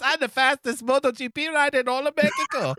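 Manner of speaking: very high cartoon voice with Mexican accent; mock Mexican cartoon boast; Mexican cartoon character. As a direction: squeak out a hammed-up Mexican accent. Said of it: "everyone's favorite Mexican cartoon character".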